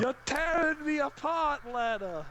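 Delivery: high, loud overwrought cry; hammy movie line. Pretend. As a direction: wail it like a bad actor. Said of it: "Or would it be".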